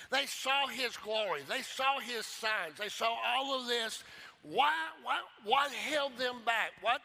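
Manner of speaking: loud, rising preacher's climax; preaching at full force. Acted. Preacher pressing the congregation with rising force. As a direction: build it up, hammer each line.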